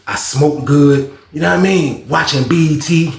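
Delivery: ordinary talk, nothing marked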